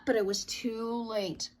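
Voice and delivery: plain reading voice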